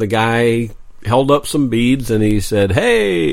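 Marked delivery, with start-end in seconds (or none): 2.7-3.3 high, drawn-out rocker's "hey"; imitating a rock singer working the crowd; rock and roll voice. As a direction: call it out like a band frontman. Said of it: "in that rock and roll voice".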